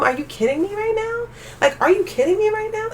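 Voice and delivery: are you kidding me, shrieked high; high exasperated outburst. Real, not acted. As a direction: pitch jumps way up in disbelief. Ranting about PS5 giveaways.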